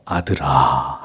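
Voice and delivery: low, lingering fatherly call; tender. Acted. Father calling his angry elder son, tender. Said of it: "다정하게 큰아들을 부르고 있습니다".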